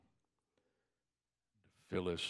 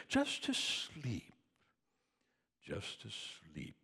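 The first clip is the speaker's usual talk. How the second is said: poem recitation; 4 semitones lower, 4 dB louder than usual. Acted.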